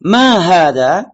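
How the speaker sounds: loud, slow, drawn-out question; reading for repetition. Shown as a demonstration. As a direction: model it slowly and loudly for the class. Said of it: "Baik, kita baca bersama".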